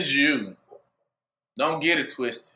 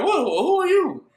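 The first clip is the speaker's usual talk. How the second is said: higher, puzzled townsfolk; the crowd baffled by Yahusha, higher voice; 9 semitones higher than usual. Retold or imitated. Higher bewildered crowd voice.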